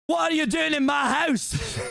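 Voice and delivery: high, drawn-out Scottish bellow; aggressive Scottish Shrek. Acted. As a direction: roar it slowly in an aggressive Scottish accent. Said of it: "my aggressive Scottish accent".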